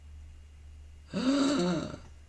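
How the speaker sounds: long high theatrical gasp; dramatic gasp. Real, not acted. Reacting to lost feature.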